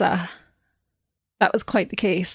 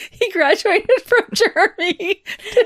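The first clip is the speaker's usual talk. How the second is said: laughing; 10 semitones higher, 3 dB louder than usual. Real, not acted.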